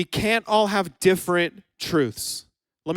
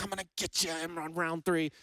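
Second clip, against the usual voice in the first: soft, hushed gamer muttering into a headset; low taunt as if into a headset mic; gamer trash talk; 4 semitones lower, 13 dB quieter than usual. Retold or imitated. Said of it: "You're like".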